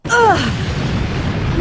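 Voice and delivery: sharp grunt of strain, high; a startled grunt as she's thrown; effort grunt. Acted. Struck down in a sparring fall.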